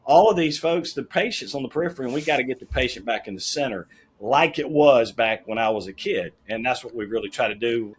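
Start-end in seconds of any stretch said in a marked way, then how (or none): none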